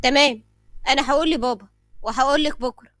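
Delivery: loud, smug acknowledgement; very sly. A narrator's reading. Ayham puts Doaa off till tomorrow, slyly. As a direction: wrap it up slyly, keeping the secret. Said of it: "كان أيهم بيتكلم بخبث شديد".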